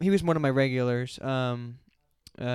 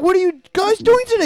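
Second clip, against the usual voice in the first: much higher, louder put-on voice; squeaky impression; 10 dB louder than usual. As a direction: squeal it like a cartoon. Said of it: "he's like".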